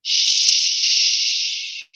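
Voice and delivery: a soft, long "Shh" hiss with no voice; quiet shh. Shown as a demonstration. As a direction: hush like quieting a room. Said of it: "It's the quiet sound".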